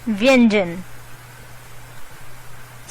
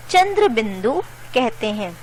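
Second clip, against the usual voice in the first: usual speaking voice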